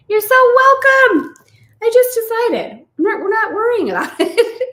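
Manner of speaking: high, loud and laughing; squealing with delight at the glitch; giddy. Real, not acted. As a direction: bubble over with giddy joy.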